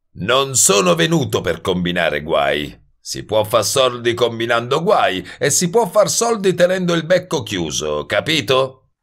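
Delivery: pushy wise guy, pitch climbing into a challenge; brash wise guy. Acted. Voices Goebel, brash troublemaker. (sbruffone arrogante, voce più acuta)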